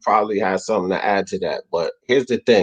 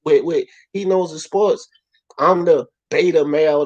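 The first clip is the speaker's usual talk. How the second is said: ordinary delivery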